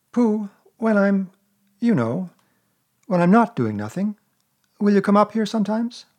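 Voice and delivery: put-on a small boy catching on; boyish Christopher Robin. A narrator's reading. Christopher Robin voiced higher.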